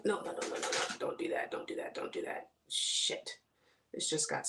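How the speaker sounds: urgent hushed muttering to yourself; unvoiced, under-the-breath repeated plea; whispered pleading. Real, not acted. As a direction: whisper frantically at something going wrong. Pleading with a stuck lash.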